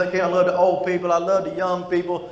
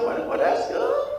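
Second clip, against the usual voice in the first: mock sweet reply; 3 dB quieter than usual. Mock polite reply to old lady.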